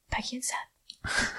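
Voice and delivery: breathless starstruck whisper; hushed, stunned whisper; awed whisper. Retold or imitated. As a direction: whisper their names in disbelief. Starstruck reaction on seeing idols.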